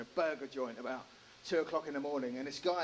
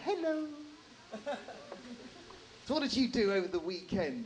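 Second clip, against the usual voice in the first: high chirpy "Hello" from an eager mum; lightly voiced friendly mum; over-friendly mum; 8 semitones higher than usual. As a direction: greet like a bounding church mum. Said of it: "saying something like".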